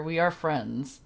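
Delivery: usual speaking voice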